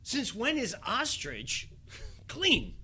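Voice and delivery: mock shocked Peter asking about ostrich; incredulous question. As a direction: ask it amazed, with a laugh before the last word. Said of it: "what's shocking here is".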